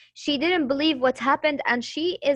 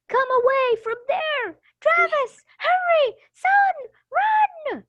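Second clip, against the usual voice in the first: mother's shout; 14 semitones higher than usual. Shown as a demonstration. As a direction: yell for your boy to run home. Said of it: "if there's shouting in her voice, you have to shout".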